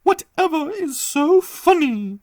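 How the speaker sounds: put-on a fragile old lady musing; old lady's voice. Madame Lavon asks.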